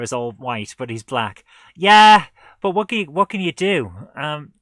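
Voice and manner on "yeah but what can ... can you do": loud, higher throw-up-hands cry; exasperated shout. Real, not acted. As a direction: shout it in resignation. Exasperated exclamation.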